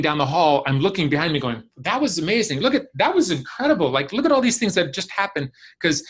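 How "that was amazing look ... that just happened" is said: retold wonder in a much higher voice; high-pitched, excited look back in amazement; amazed. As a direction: re-enact marvelling at what just happened. Re-enacts amazement looking back.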